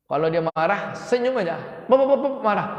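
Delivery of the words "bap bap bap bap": mock angry babble. Imitating someone's angry scolding.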